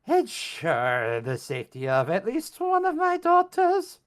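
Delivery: high, slow, raspy hag; old crone voice. Acted. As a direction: creak it out like an ancient witch. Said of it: "says the old crone".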